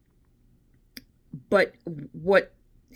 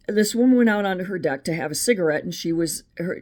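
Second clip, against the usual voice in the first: ordinary talk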